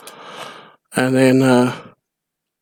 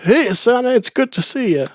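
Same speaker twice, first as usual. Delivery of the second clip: greeting voice; 10 semitones higher than usual. Retold or imitated. Game character greeting.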